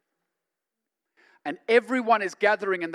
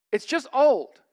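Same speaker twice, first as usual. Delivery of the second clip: louder repeat; 7 semitones higher, 7 dB louder, slower than usual. Shown as a demonstration.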